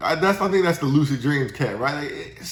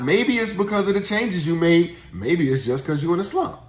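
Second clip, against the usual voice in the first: usual speaking voice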